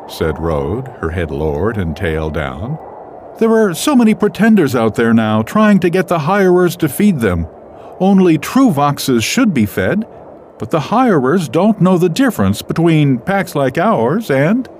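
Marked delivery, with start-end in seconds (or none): 3.4-14.7 higher, louder she-wolf voice; female character voice. Acted. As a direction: play the worried mother wolf.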